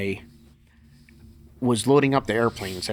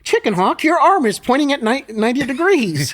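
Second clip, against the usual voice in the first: higher, louder, incredulous; exasperated retort; 13 semitones higher, 6 dB louder than usual. Retold or imitated. Doug Heath telling stubborn lifter his arm is broken, exasperated. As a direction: point out the obvious, loudly. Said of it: "he said".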